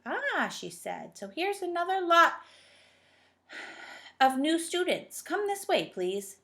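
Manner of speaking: put-on high, loud bossy old dame; stern old schoolmistress. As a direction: talk down to the children as the headmistress. Dame Slap greets new students.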